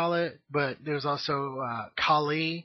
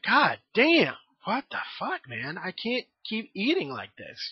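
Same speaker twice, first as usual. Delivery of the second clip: higher-pitched, retold exasperation; exasperated outburst; 4 semitones higher than usual. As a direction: act out being fed up, pitch jumping up. Retold exasperated reaction to overeating.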